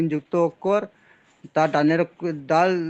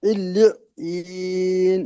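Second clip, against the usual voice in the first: Quran recitation; much slower than usual. Real, not acted. Student repeats the word.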